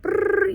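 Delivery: high quick lip trill "Brrr"; mock eye flutter. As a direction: buzz your lips high like fluttering eyes. Eyes fluttering sensation imitated.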